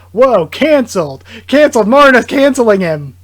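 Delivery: excited shout. Real, not acted. Reader reacting to Marna's attack, excited shout. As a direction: yell it out like the scene just blew your mind. Shocked reaction to violent panel.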